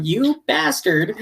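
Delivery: high, slow mock outrage at the screen. Fan cursing a cruel anime character, high and slow mock outrage. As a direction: drag out the insult in playful indignation. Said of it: "I'm going".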